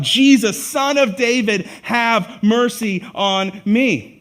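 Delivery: loud, slow cry for mercy; calling out. Retold or imitated. Blind beggar crying out over the crowd, loud pleading call. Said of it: "He called out".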